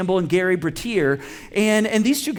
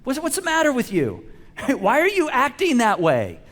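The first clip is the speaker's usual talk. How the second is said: exasperated demand; 6 semitones higher than usual. Retold or imitated. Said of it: "No, we say".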